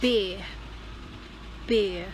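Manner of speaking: "beer" with the R dropped; silent R. Shown as a demonstration. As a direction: punch out "beer" as "bee-uh", no R. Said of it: "you don't have to say the R".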